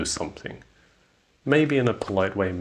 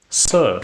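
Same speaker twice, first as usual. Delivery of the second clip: "Sir" pitched high and louder to get attention; louder, lifted "Sir" to catch a customer's attention; rising intonation on Sir; 4 semitones higher, 8 dB louder than usual. Shown as a demonstration. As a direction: call out "Sir" with a raised, questioning lift. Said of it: "has a rising intonation".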